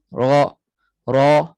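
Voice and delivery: Ra, ra loud with unrounded lips; Ra, ra said loud with an open mouth; plain open ra. Shown as a demonstration. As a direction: say Ra, ra without rounding the lips. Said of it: "begitu sahaja".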